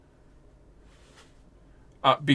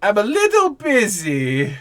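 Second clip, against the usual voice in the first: put-on old wizard voice; 7 semitones higher than usual. Old wizard brushing them off.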